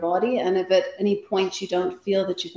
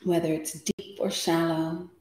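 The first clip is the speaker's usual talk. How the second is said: guided meditation. Acted. Yoga teacher settling the class before practice, guided meditation. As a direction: guide them into stillness, unhurried, phrase by phrase.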